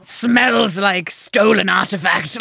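slow, mock connoisseur sniffing the air; posh sniffing. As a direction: savour the smell like a snob. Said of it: "stand and go".

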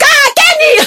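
high-pitched shout of a name across the room; retold shout. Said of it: "I was shouting".

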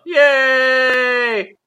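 high, loud cheer of joy; excited cheer. Real, not acted. Cheering the Journal Club segment.

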